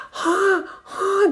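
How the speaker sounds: tiny, very high-pitched newborn cry; cute baby cry, imitated. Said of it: "すごい可愛い声で泣くんですよ". (赤ちゃんの可愛い泣き声の真似、とても高い声で)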